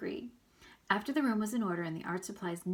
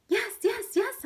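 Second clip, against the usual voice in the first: squeaky hamster; 8 dB louder, slower than usual. Acted. Hamster Humphrey agrees in squeak.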